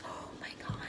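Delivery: breathless whispered wonder; stunned whisper at the crown moldings; awed whisper. Real, not acted. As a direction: whisper it in awe.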